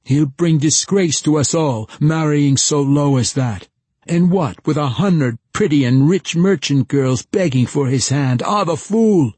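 high, loud gruff villager ranting; gruff village man. Acted. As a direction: rant like an outraged village man. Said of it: "now in the gruff tones of a village man".

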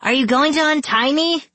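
very high, urgent plea; high-pitched frantic question; desperate cry. A narrator's reading. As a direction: cry out as a tied-up captive. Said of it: "Scarlet cried".

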